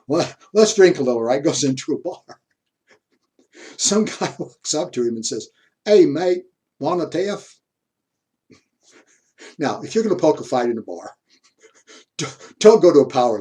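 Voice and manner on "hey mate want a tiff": lightly acted Australian bar challenge, higher and louder; hint of an Australian accent, calling someone out. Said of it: "when the Australian stood up and says".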